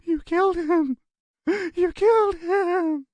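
keen it over and over; broken man chanting his grief. A narrator's reading. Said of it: "making a keening sound".

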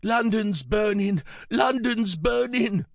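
high, drawn-out croaking cry; old man's croak. A narrator's reading. Pritch suddenly crying out a memory, high croak. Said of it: "he croaked".

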